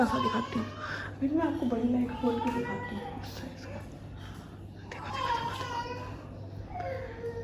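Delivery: breathy whisper, almost no voice. Real, not acted. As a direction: sneak in whispering so she won't notice. Sneaking up on crying baby.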